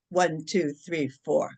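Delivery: low, even count with spaces between numbers; measured counting. Shown as a demonstration. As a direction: count them calmly through the breath. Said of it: "and I'll go".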